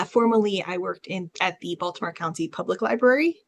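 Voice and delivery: ordinary talk, nothing marked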